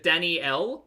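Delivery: Danielle as dan-ee-ELL, three beats; three-syllable name. Shown as a demonstration. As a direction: sound a clear "ee" inside Danielle. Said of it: "is it three syllables or two".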